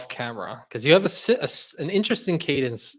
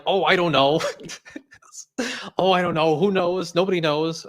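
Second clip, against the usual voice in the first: mock clueless shrug, a bit louder; imitating Anthony Ventura; 5 semitones higher, 4 dB louder than usual. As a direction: play dumb in his voice, lightly. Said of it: "How would you do a, a, an Anthony Ventura impression?".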